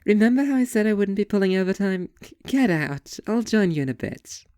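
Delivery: high, sassy waitress picking his speech apart; waitress mocking a customer's edgy monologue. Waitress figure voiced in story.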